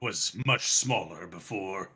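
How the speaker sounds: lightly voiced, deliberate dragon; old dragon with long pauses between thoughts; ancient dragon. Dragon looking forward to razing the citadel, deliberate and lightly voiced.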